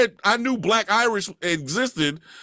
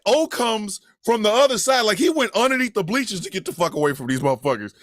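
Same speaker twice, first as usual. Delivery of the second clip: ordinary talk, nothing marked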